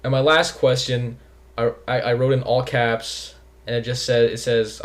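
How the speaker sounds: normal talking voice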